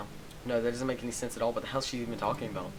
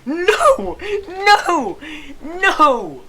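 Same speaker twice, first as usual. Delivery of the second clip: much higher, very loud repeated cries; anguished shouts; 13 dB louder, much slower than usual. Real, not acted. Gamer screaming at the choice to delete Monica. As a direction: scream no in despair.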